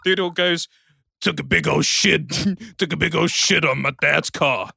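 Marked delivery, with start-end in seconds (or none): 1.2-4.7 put-on rough old smoker voice for the cat; fifty-year-old smoker. Cat brags to the presenters, rough smoker voice. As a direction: play the cat as an aged smoker. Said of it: "Doodle sound like that? ... like a fucking fifty-year-old smoker".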